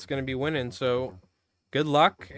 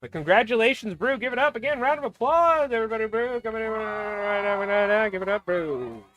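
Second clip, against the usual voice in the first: excited, raised play-by-play calling the marbles; live race commentary; 8 semitones higher than usual. Acted. Streamer calling a marble race for viewers, hyped play-by-play. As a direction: call the race like a hyped sportscaster.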